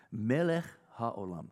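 slow Hebrew "Melech haolam", throaty ch in "Melech"; chant-like "Melech haolam", drawn out; Hebrew blessing. Shown as a demonstration. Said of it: "a formula that is invoked".

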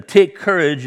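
a loud, firm "Take courage," lifted up; Jesus calling across the waves to calm them; bold reassurance. Retold or imitated. Jesus calling to frightened disciples in the storm, a bold loud reassurance. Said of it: "Jesus immediately said to them".

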